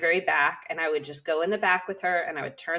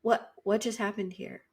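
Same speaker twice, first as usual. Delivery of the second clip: higher, softer imitation of baffled onlookers; hushed disbelief; 4 semitones higher, 5 dB quieter than usual. Onlookers asking the vet tech about the tamed dog, hushed disbelief. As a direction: voice their astonishment under your breath, pitched up. Onlookers' amazed reaction, voiced.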